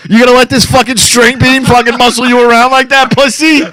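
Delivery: high mocking taunt, rising at the end; goading friend. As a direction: egg him on with scorn. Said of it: "I'm like".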